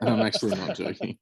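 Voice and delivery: laughing while insisting. Real, not acted. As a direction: keep laughing through it.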